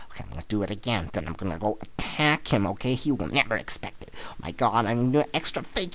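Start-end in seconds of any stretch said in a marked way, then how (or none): none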